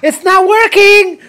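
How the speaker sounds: mock strained whine. Girl straining on choke, retold.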